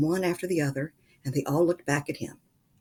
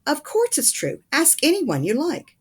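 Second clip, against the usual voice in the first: high, eager insistence; higher, emphatic old folks insisting; insisting excitedly; 11 semitones higher, 5 dB louder than usual. A narrator's reading. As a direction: press it on him, all excited.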